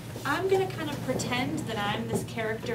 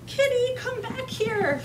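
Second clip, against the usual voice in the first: high, slow pleading call; Ethan calling his cat; 11 semitones higher, 4 dB louder, slower than usual. Pretend. Teacher pretending to be a boy whose cat ran away, calling out. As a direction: call after a runaway pet, drawn out. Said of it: "pretend that I'm Ethan".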